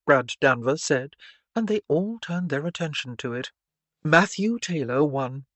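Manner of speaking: ordinary delivery, nothing marked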